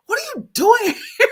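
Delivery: high loud laughing replay; laughing shocked squeal. Retold or imitated. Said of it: "I actually kinda surprised myself".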